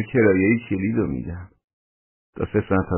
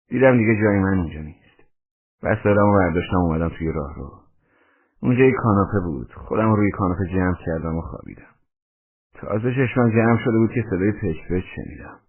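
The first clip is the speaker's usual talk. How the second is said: ordinary delivery, nothing marked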